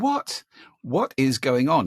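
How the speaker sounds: sharp high disbelieving what; exasperated what is going on; incredulous. Retold or imitated. Speaker retells his own dismay.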